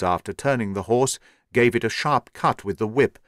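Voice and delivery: ordinary delivery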